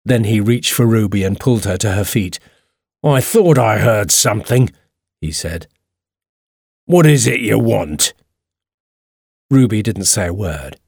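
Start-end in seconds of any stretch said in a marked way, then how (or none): 3.0-4.7 lightly voiced gruff intruder-catcher; a touch of menace in a character voice; angry man. A narrator's reading. Man who caught Ruby in his house, mildly angry. 6.8-8.1 lightly voiced angry homeowner; angry man. A narrator's reading. Man questioning the girl he caught, mildly angry.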